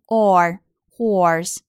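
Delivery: "horse" with an American r-coloured or. Shown as a demonstration. Teacher shows American pronunciation, r-coloured "or" in "horse". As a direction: slowly sound the r in "or" and "horse". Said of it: "美式发音".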